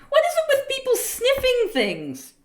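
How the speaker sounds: high, loud laughing disbelief. Real, not acted. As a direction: ask it through a burst of laughter. Cracks up at the odd name.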